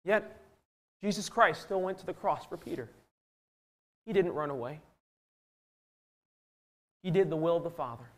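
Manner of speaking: soft, measured, with long pauses; hushed solemn preaching. Real, not acted. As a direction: state it quietly and reverently.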